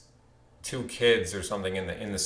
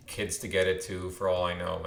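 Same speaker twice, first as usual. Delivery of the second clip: ordinary delivery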